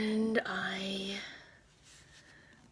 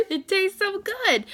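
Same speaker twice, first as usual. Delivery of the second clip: laughing, higher and louder, slow; 12 semitones higher, 9 dB louder than usual. Real, not acted. Confessing her love of husband's cooking, laughing. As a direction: giggle the words out through real laughter.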